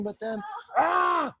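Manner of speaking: high, slow drawn-out alarmed "ah"; dramatic gasp. A narrator's reading. Reading to kids, a drawn-out alarmed gasp. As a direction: gasp as the pile starts to slip. Book scene, animals about to slip.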